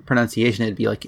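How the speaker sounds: ordinary delivery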